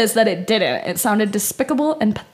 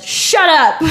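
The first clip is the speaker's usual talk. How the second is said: higher, louder narrated shout; yelled; 6 semitones higher, 6 dB louder than usual. A narrator's reading. Narrator yelling at the weeping woman, loud. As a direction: snap it out as a yell. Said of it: "I yelled".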